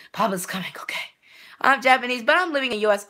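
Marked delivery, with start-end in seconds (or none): 0.1-1.0 soft, lower secret whisper; breathy teasing whisper; playful whisper. Pretend. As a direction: whisper it like a sneaky promise. Whispered aside to game.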